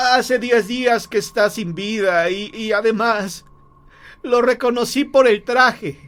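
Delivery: high, urgent, horrified protest; trembling husband. Acted. Frightened husband argues with his wife, appalled. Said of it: "El hombre estaba temblando".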